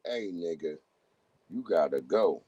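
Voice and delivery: dropped low and cool; low dismissal. Pretend. Imagining sending someone packing, low dismissal. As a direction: tell them off in a deep, low voice.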